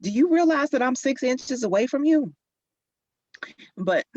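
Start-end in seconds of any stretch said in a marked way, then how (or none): 0.0-2.3 high, louder, incredulous; telling a loud daughter off from close by; exasperated. Retold or imitated. Mom chiding daughter for talking too loud, exasperated. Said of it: "I was like".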